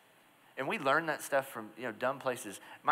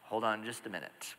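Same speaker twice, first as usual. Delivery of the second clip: softer, sheepish pulling back; tone change; 3 semitones lower, 7 dB quieter than usual. Retold or imitated. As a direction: back down gently. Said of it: "listen to his tone change".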